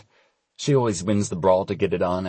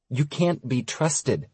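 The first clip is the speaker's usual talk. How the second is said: slow, higher-pitched mild reproach; exasperated reproach; 6 semitones higher, slower than usual. A narrator's reading. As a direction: cut in with drawn-out disapproval. Stella cuts off his joke.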